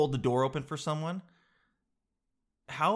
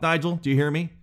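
ordinary talk, nothing marked

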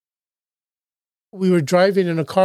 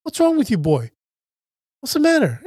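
much higher, mock gruff dad asking what's wrong; father's voice; 7 semitones higher than usual. Son imitating his father asking why he's crying, pitched well up. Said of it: "my father looked at me and goes".

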